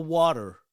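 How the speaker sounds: water as WAH-der with a tapped T; American pronunciation. Shown as a demonstration. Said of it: "when I went to America".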